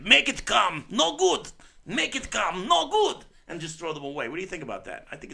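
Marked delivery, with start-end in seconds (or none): 0.0-3.2 put-on loud, high-pitched heavy foreign accent; Steven Seagal-parodie accent. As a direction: shout it in a mock foreign accent. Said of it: "And be like".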